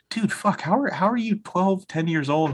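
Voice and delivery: high-pitched retold astonishment; amazed dad. Dad to his young son after a movie talk, amazed. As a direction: act out a dad floored by his kid.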